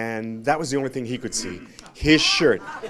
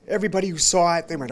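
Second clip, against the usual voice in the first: ordinary talk, nothing marked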